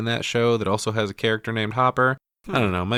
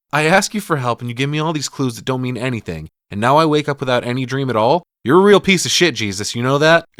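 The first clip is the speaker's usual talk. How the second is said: higher, louder scolding, acted out; pissed-off prayer; 4 semitones higher, 6 dB louder than usual. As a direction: tell Jesus off, building in indignation. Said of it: "and she's pissed".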